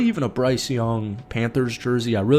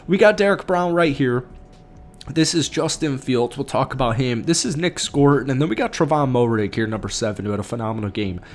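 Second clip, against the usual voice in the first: usual speaking voice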